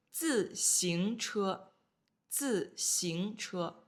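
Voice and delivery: 自行车 said very slowly, syllable by syllable, each tone clear; slow careful model. Shown as a demonstration. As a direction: spell out 自行车 slowly for learners. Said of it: "自, fourth tone".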